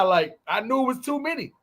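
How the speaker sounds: normal talking voice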